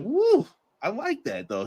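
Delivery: high, slow, impressed woo at the line; delighted woo. Retold or imitated. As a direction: savour it like a great promo. Reacting to a wrestling promo.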